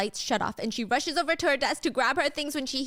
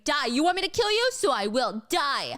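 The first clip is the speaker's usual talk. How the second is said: high, raised mock scream of rage; 4 semitones higher, 3 dB louder than usual. Michael screams at Gia while choking her, screaming. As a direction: scream it like a killer, short of full volume. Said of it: "he starts screaming".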